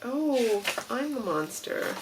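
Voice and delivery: sheepish realization as the puppet; Grover realizing. Retold or imitated. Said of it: "so then he goes".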